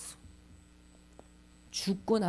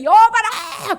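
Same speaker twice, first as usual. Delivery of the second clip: high, loud royal summons; angry shout; 11 semitones higher, 11 dB louder, much slower than usual. Acted. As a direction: yell for the guards as a furious king. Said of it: "화를 소리 소리 지르니까". (화난 임금의 호령)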